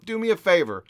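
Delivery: ordinary talk, nothing marked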